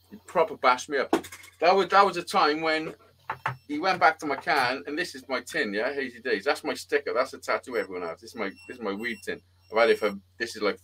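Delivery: normal talking voice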